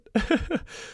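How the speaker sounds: high giggle. Real, not acted. Laughs at his own joke.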